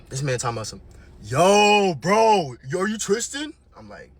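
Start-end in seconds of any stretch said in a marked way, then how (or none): 1.2-3.5 louder, lightly voiced dude greeting; a hint of an eager new boyfriend; hyped bro voice. Mimicking the new guy greeting Tristan on FaceTime, hyped bro voice.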